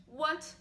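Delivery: "what" held on a level pitch; level-toned "what"; first tone. Shown as a demonstration. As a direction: say "what" evenly, as in the first Chinese tone. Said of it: "put on the four syllables for what".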